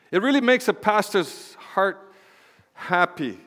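sarcastic. Acted. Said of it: "being sarcastic right there".

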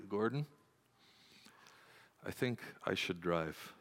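low, very soft, forced calm; hushed, carefully controlled suggestion; calmest, quietest voice. Retold or imitated. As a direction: say it as quietly and calmly as you possibly can. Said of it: "the calmest, quietest voice I could".

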